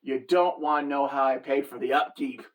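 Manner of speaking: low, teasing retort, not quite whispered; mocking aside. Mother mockingly confiding to daughter about the minivan, low mocking aside. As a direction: drop the pitch for a sly mock confidence. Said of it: "I whisper kind of, you know, mockingly".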